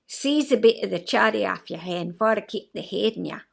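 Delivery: amateur broad Scots phrase; put-on Scots, practised line by heart; rehearsed Scottish accent. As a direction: recite it in learned Scottish dialect. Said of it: "my friend Heather had taught me".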